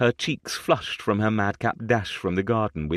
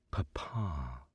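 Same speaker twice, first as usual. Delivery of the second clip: much softer, low and tender; soft echo; 4 semitones lower, 12 dB quieter than usual. A narrator's reading. Father savours his title, soft tender echo. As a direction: say it as a cherished word.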